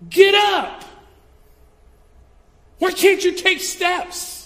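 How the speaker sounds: very high, loud mock yell at a toddler; hammed-up impatient shout; mock shouting slowly at a fallen toddler; mock yelling parent. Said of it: "looked at them and said".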